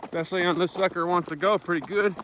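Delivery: ordinary talk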